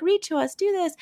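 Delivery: put-on high-pitched child requests; imitated demanding kids. Kids asking their caregiver, imitated child voices. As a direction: lightly mimic children begging a caregiver.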